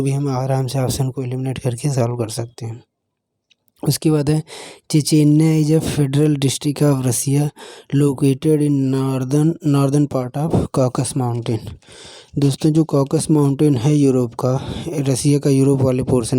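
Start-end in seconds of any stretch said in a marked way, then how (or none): 4.9-11.6 slow dictation. Shown as a demonstration. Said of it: "teacher dictates a definition".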